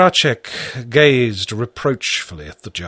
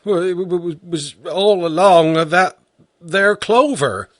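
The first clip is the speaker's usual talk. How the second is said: halting, stuttering start, a bit higher; stammering peasant; 7 semitones higher than usual. A narrator's reading. Peasant stammers his answer to the judge. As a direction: trip over the first words, bewildered. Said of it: "he stammered".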